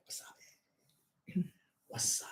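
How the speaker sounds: drawn-out voiceless whisper; playful whisper. Pretend. Host greeting a viewer, playful whisper. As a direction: whisper it long and hushed.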